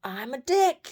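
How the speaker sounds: high, loud crowing words; imitating a rooster's crow. Woman translates her rooster's crowing, imitating the crow. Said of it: "cock-a-doodle-doing".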